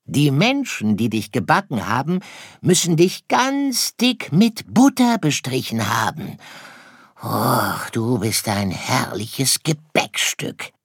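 high, swooping fox voice; storybook fox savouring a treat; greedy fox. Acted. Fox admires his treat. (gieriger Fuchs, schwärmend)

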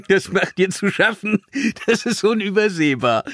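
amused, high teasing through a chuckle; laughing. A narrator's reading. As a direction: tease a friend while laughing. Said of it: "Finn musste lachen".

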